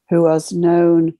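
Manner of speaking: ordinary talk, nothing marked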